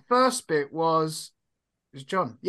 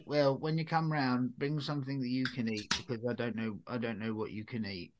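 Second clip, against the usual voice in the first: softer, lower, trailing nan voice; imitating nan; 6 semitones lower, 11 dB quieter than usual. Nan telling grandchild to bring food, imitated nan. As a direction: lightly voice a nan, low and soft. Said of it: "my nan does that when I go, she goes".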